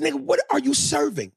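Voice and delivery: mock outraged question. Teasing the caterer loudly.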